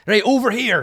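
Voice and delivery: loud, high attention-getting shout; calling out. Acted. Durnan distracts the troll from the caster, calling out. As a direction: shout to draw it your way.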